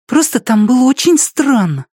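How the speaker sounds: put-on high child's voice; small boy telling a dream; little boy voice. Boy character voice.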